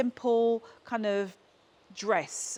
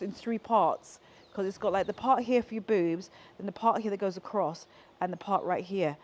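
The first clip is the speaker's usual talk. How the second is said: ordinary delivery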